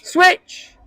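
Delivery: high, sharp on-pitch call; shouted call. Shown as a demonstration. Coach demonstrates the call players should shout, shouted. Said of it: "what do you shout".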